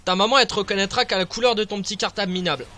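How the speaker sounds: fast, lightly voiced taunt; mock quote of a game character's insult; Pokémon trainer quote. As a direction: rattle off a cartoon rival's taunt. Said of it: "Comme ils disent dans Pokémon".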